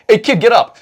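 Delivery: a hammed-up Jesus shout; mock Jesus call. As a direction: jokingly call the dead kid up. Jesus voiced raising kid.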